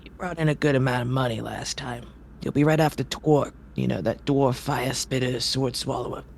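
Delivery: a gruff, impatient circus ringmaster scolding. Acted. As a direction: grumble at the late performer. Ringmaster reads schedule.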